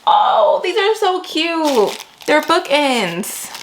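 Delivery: high, slow delighted coo; delighted gasp. Real, not acted. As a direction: melt over something adorable. Unboxing cute bookends.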